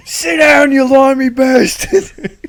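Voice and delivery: hammed-up Australian taunt through laughter; mock Aussie yell. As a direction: yell it like a rowdy Aussie heckler.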